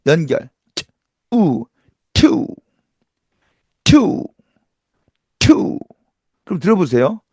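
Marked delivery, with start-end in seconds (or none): 0.7-1.7 트 then 우 said as separate sounds; sounds apart. Shown as a demonstration. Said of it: "따로따로 발음해서 연결". 2.1-5.8 투 said as a t burst with a separate oo; English two. Shown as a demonstration. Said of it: "따로따로 발음해서 연결".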